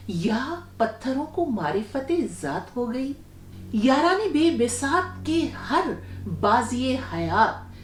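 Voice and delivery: ghazal recitation. Acted. Host reciting the poet's full ghazal to listeners, verse recitation. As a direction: recite each line with pauses between.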